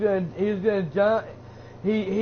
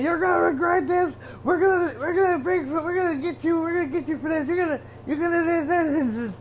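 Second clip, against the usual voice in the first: mock whiny threat, much higher; a wrestler's petulant threat, squeaked up high; mocking Sami Zayn; 8 semitones higher than usual. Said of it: "Sami Zayn".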